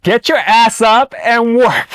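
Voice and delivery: slow, high, drawn-out order; high and slow, hammed-up commanding line; commanding quote. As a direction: bark it out slowly like a tough boss. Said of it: "You have to give the quote".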